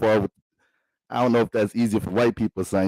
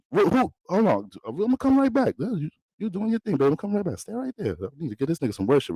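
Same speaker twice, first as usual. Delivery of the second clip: Jesus sweet-talking; 6 semitones higher than usual. Pretend. Jesus voiced excusing himself.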